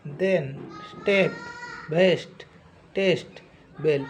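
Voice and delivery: "पेन" "टेन" short "ए", spaced apart; short e word drill. Shown as a demonstration. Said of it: "ए छोटा cut करना है". (हर शब्द में "ए" छोटा और ज़ोर से)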